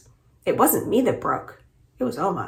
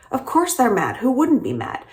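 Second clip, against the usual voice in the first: raised pitch, mildly annoyed retort; 6 semitones higher than usual. A narrator's reading. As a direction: answer back with mounting irritation. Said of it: "Appa's the one who sounds annoyed".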